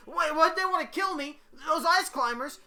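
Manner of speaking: high, loud panicked disbelief; frightened high-pitched outburst; acted scared. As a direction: cry out in alarm at being targeted.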